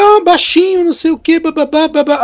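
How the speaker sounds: retold calling out. Old classmate spots him at night school, retold calling out.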